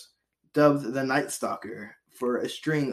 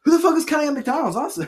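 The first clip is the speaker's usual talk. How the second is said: laughing outburst; 9 dB louder, much faster than usual. Real, not acted.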